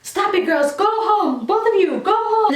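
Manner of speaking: stern teacher. Retold or imitated. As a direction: order both girls out. Teacher breaks up the fight.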